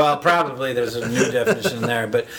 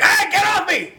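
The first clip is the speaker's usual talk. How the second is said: panicked shout; 4 dB louder than usual. Retold or imitated. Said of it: "and he's like".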